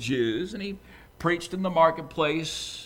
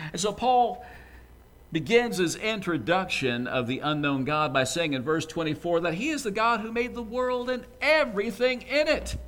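usual speaking voice

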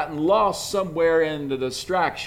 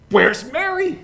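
higher, louder exasperated call; Martha calling out for Mary; 12 semitones higher, 7 dB louder than usual. Acted. Martha in the kitchen calling for Mary, exasperated. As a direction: shout for the missing sister, fed up. Martha hunting for her sister.

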